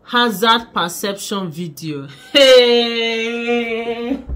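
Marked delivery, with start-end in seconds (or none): none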